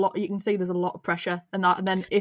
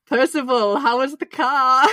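imitating Carlos on the radio; 3 semitones higher, 6 dB louder than usual. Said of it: "Carlos on the radio like".

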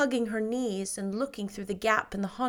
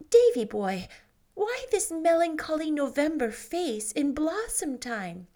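put-on light, higher girlish voice, dreamy; Anne's voice; 7 semitones higher than usual. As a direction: lift the voice a little for young Anne. Anne answers Marilla.

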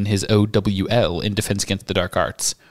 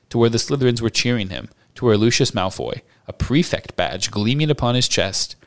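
ordinary delivery, nothing marked

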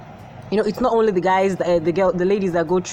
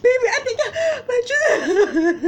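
crying. Acted. Wife cries in the quarrel.

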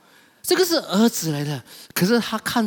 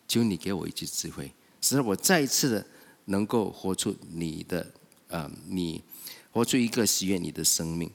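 hushed, much lower prayer to God; quiet pleading prayer, lower and softer; humble prayer; 9 semitones lower, 5 dB quieter than usual. Acted. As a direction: pray it softly and humbly. Preacher voices a humble prayer.

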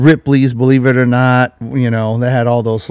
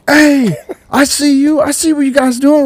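lightly voiced boyfriend spotting them; catching them out; 12 semitones higher than usual.

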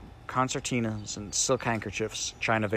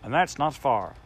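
slow, raised and louder scolding; cross; 5 semitones higher, 5 dB louder, slower than usual. A narrator's reading. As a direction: talk down at him, annoyed. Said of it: "said the Samiad crossly".

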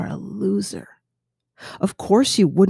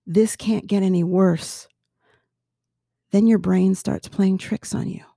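ordinary talk

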